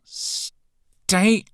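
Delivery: high, loud and slow, rattled; flustered. A narrator's reading. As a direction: snap it out flustered. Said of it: "in a flustered tone".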